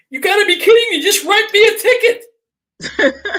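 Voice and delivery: high, slow, whining mock plea; exasperated plea. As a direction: beg him in a put-on exasperated whine. Pleading with the officer.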